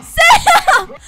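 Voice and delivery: shrieking laugh and high call; laughing scream. Real, not acted. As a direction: laugh while screaming for help.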